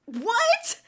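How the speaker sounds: loud, high-pitched rising disbelief; incredulous. Acted. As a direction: shoot the question up in shock. Stunned at being dismissed.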